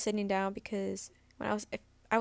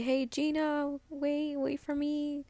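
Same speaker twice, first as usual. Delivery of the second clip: higher-pitched imitation of someone calling after a runner; mock calling out; 5 semitones higher, slower than usual. Ex trying to catch up with her, imitated calling out.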